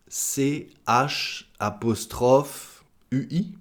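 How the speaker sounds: ordinary delivery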